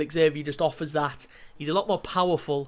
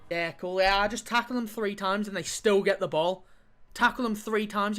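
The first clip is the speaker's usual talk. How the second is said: usual speaking voice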